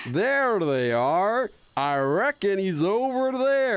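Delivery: very slow, stretched Texas drawl; bad fake Texas accent. Streamer trying out a fake Texas accent, drawn-out. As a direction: stretch out a fake Texan twang. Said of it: "gonna do the bad fake Texas accent".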